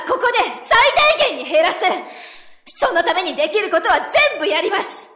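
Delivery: loud, high and forceful tirade; heated defiance. Acted. Subordinate defying the board at a meeting, angry tirade. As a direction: tear into the board, no backing down.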